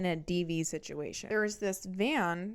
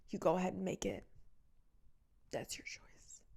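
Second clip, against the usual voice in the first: wounded martyr voice; 7 dB quieter than usual. Retold or imitated. The ex accepting the breakup, hushed martyr voice. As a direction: sigh it out like a noble victim. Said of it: "He started doing this face".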